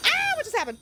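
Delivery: high startled yelp; surprised. Real, not acted. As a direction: caught off guard by an alert. Surprised by follow alert.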